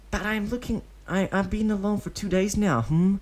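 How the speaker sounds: high, small pleading voice; a lonely kid tugging at sympathy; pitiful child. Acted. Deidara telling Obito he's been alone, high pitiful child voice. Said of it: "sounding pitiful".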